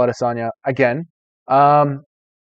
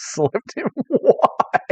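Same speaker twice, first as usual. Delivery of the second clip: breaking into high laughter on "Why"; laughing; 8 semitones higher, 5 dB quieter, much slower than usual. Real, not acted. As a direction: crack up as you get the words out. Cracks up reading chat message.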